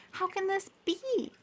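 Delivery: high, shrill-edged outraged cry; angry Pluto. A narrator's reading. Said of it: "he shouted".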